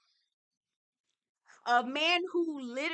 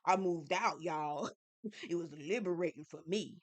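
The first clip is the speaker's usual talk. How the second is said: mocking Ralph; 5 semitones lower, 5 dB quieter than usual. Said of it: "says it with his motherfucking chin".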